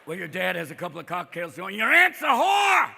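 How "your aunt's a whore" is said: loud, high, drawn-out holler; drunken blurt. Retold or imitated. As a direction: blurt it out loud like a tipsy dad. Drunk dad blurts insult at dinner.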